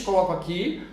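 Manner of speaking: ordinary delivery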